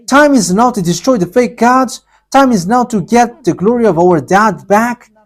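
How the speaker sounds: ordinary talk, nothing marked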